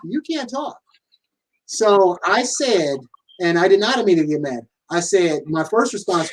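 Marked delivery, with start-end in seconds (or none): 0.1-0.8 high, soft mock of his wife. Husband mimicking his wife cutting him off, high and soft. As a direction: squeak it like a teasing imitation.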